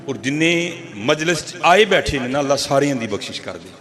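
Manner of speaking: low, soft, pleading prayer; humble prayer. Acted. Slave softly recites his plea for everyone's forgiveness. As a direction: ask for mercy humbly.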